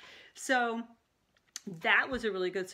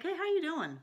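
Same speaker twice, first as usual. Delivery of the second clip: casual waiter greeting; 9 semitones higher than usual. Retold or imitated. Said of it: "just like".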